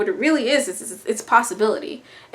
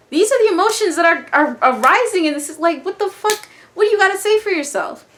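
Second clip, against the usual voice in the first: higher, louder, fast challenge; confrontational Pisces; 7 semitones higher, 4 dB louder than usual. Acted. Pisces confronts someone ignoring emotions, higher and fast. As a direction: push back hard and quick, half-joking.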